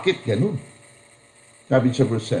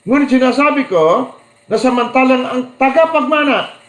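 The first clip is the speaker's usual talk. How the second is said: raised, proclaiming Bible reading; scripture reading; 10 semitones higher, 4 dB louder than usual. Acted. Preacher reading Galatians to the congregation, proclaiming. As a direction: proclaim the verse to the church. Reads Bible verse aloud.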